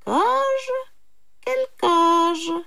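very high, loud bewildered questions; puzzled jackal. A narrator's reading. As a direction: squeak it in puzzlement. Jackal feigns confusion about cage. (chacal perplexe, voix très aiguë)

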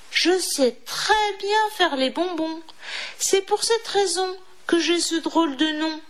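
high, eager storybook mouse; pleading little mouse. A narrator's reading. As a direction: talk the wolf round in a high little voice.

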